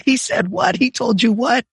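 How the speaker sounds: shocked mom ready to storm in, high; outraged disbelief. Retold or imitated.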